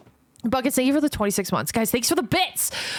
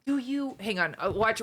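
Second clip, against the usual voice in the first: normal talking voice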